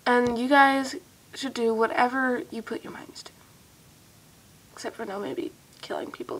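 soft unvoiced encouragement; whispering. Real, not acted. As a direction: whisper it warmly, then joke. Encouraging fans, joking aside.

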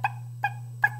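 short voiceless pecks; imitated hen pecking; pecking noises. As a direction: tap out sharp little beak sounds. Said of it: "She's like".

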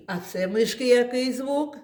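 normal talking voice

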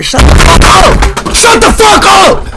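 real rage, yelling over and over; all-out furious yell at the chat; furious shout. Real, not acted. Streamer raging at spamming chat.

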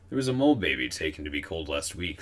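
ordinary delivery, nothing marked

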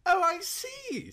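high, slow kindly drawl; a kindly lord, stretching it out; kindly earl. A narrator's reading. Earl answering his humble visitor kindly and slowly. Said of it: "said the kindly Earl".

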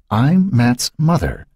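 high, slow reveal; drawn-out confession; revealing herself. A narrator's reading.

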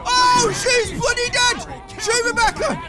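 high, stretched-out cry of alarm; shocked outcry. Real, not acted. Player watches game character die, shocked cry. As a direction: exclaim in shock, pitch jumping up.

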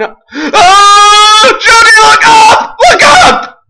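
high, loud shriek of alarm; screamed warning. Real, not acted. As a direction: scream to warn a teammate in danger. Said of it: "I screamed at you about that creeper".

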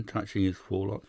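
usual speaking voice

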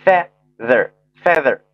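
slow "feather", syllables fe and ther; joined-up blending. Shown as a demonstration. Teacher joining up the sounds for learners, slow model reading. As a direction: say feather slowly and carefully as a model. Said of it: "连起来".

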